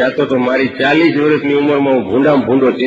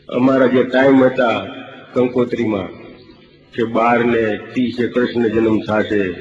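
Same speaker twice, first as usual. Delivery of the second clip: ordinary talk, nothing marked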